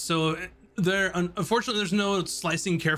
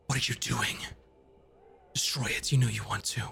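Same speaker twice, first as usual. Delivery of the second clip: low, hushed seething sword voice; 3 semitones lower, faster than usual. Acted. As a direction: hiss it low and tempting, barely held rage. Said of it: "almost in a seething tone".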